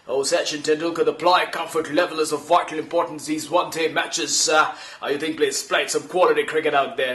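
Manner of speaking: gushing commentator interview voice; Tony Greig impression. Imitating Tony Greig interviewing Sachin after the match. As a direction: ask the question like a hyped-up TV presenter. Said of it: "Tony Greig was the master of hype".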